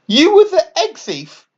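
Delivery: can't believe it's him; high shocked question; shouted in disbelief. A narrator's reading. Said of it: "Franklin shouted in disbelief".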